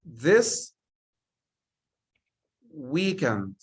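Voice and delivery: slow, word-by-word reading; spaced-out example sentence; slow dictation. Shown as a demonstration. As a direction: read it out slowly, one word at a time. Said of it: "I say".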